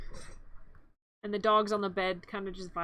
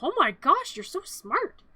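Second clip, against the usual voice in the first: higher, swooping impressed gush; mock amazement; 5 semitones higher, 3 dB louder than usual. Boy impressed by his pet's piano skill, mock gushing. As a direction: gush with exaggerated admiration.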